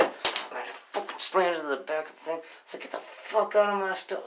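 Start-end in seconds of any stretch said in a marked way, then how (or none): 2.8-4.2 high-pitched, mild mock yell; retold angry order. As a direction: order him out, imitating anger.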